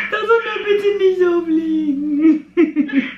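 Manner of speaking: high, slow laughing relief. Real, not acted. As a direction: laugh the words out.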